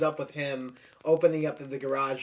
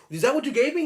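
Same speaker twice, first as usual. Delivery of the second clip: high, loud startled question; a jumpy, high-pitched demand; surprised accusation; 11 semitones higher, 6 dB louder, faster than usual. Retold or imitated. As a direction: play it as a shocked accusation. Acting out drug scene dialogue.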